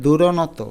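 দুরনত with a full o after ন, du-ro-no-to; wrong vowelled ন. Shown as a demonstration. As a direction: give ন its own vowel in দুরনত. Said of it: "তাহলে উচ্চারণ হবে".

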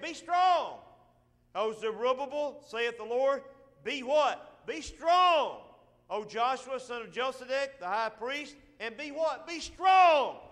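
rising preacher's call; preached command. Acted. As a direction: thunder out be strong to the crowd.

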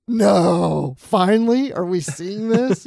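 loud, rising burst of delight; thrilled disbelief. Retold or imitated. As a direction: exclaim it like a long wish finally came true. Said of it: "I was like".

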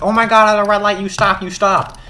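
hammed-up keen student; eager know-it-all. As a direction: imitate the kid who answers everything. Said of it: "He's going like".

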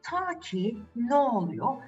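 ordinary delivery, nothing marked

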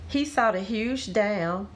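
plain reading voice

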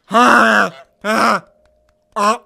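loud honking goose calls, imitated; goose honks. Recalling geese heard at summer camp, loud imitated honking. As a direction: honk it like geese overhead. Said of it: "all you would hear is".

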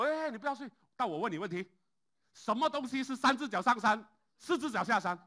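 loud, high, insistent demand; pushy wake-up call. Retold or imitated. Lawyer waking Lao Lin to ask the riddle, loud and pushy. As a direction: shake him awake and fire the riddle.

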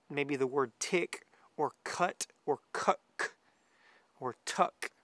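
slow, deliberate tick, cut, cuck, tuck as duck-call syllables; demo syllables. Shown as a demonstration. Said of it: "I'm saying".

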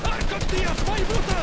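high, loud outburst; exasperated yell; urgent shout. Real, not acted. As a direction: yell orders mid-fight. Shouts at teammates mid-fight.

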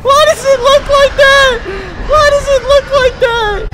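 very high, loud terrified shouting; screaming disbelief; shrieking panic. Real, not acted. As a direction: freak out at the monster. Monster appears in game.

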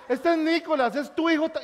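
excited announcement. Retold or imitated. Gringa's announcement retold loud.

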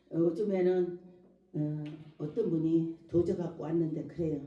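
ordinary delivery

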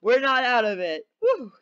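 loud, high whooping relief; elated celebration. Real, not acted. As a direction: shout it out, thrilled to be saved. Celebrates surviving the vote.

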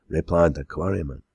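plain reading voice

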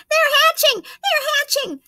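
very high, loud joyful calling; excited call. Acted. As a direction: announce the hatching with glee. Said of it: "she called".

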